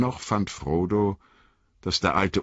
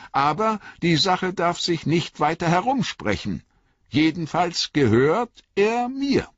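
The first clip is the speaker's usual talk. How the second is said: put-on higher, lighter young hobbit voice; earnest young hobbit, pitched up; young Frodo; 13 semitones higher than usual. A narrator's reading. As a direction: lift the voice for eager young Frodo.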